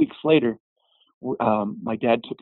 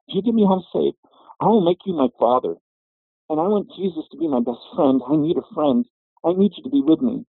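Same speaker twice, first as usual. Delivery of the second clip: pleading prayer; 7 semitones higher than usual. Retold or imitated. Retold desperate prayer in car.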